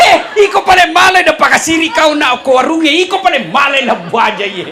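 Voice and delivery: hammed-up husband telling her off; mock husband yelling at his wife through laughter; angry husband.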